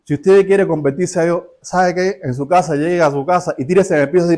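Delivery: usual speaking voice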